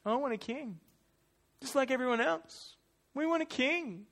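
high, petulant little whine; whining little Israelite. Acted. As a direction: whine for it like an envious child. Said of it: "You hear the whining little Israelite voice".

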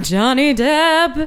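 slow, drawn-out mock swoon; swooning fan. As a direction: stretch the name out like a smitten fan. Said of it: "those that was just like".

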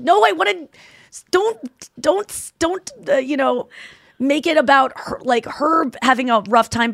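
high-pitched, frustrated pleading; exasperated protest. Retold or imitated. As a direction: object in mock despair, stumbling over the words. Voices Anya protesting Whitney's sympathetic reply.

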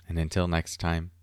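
ordinary talk, nothing marked

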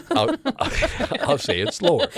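high, loud, breaking into laughter; real laughing. Real, not acted. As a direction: barely get it out for laughing. Laughing at the hosts' teasing.

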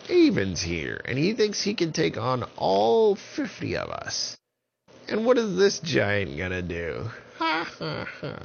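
high mocking witch voice. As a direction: sneer it out like a witch. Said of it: "And the witches said".

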